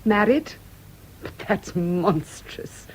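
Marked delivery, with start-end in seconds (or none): 1.2-2.9 slow, breathless whisper of outrage; shocked whisper. Acted. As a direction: whisper it, appalled. Shocked at marriage suggestion.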